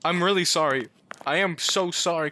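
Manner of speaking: ordinary delivery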